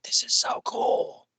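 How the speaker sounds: slow, hushed whisper of delight. Retold or imitated. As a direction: breathe it out slowly in awe. Retold excited thought.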